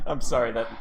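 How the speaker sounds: usual speaking voice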